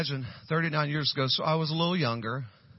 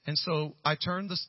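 ordinary delivery

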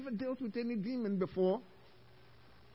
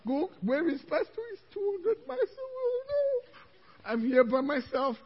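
high-pitched panicky wail; acting out helpless alarm; mock panic; 10 semitones higher, 3 dB louder than usual. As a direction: climb into a hammed-up frantic cry. Retelling panic at facing demon alone.

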